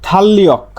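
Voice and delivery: "থাল্লিয়ক" with a doubled L; assimilated pronunciation. Shown as a demonstration. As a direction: run "থাল্লিয়ক" together with no R. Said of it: "আরেকটা উদাহরণ দেখি". ("থাল্লিয়ক" জোড়া ল দিয়ে উচ্চারণ)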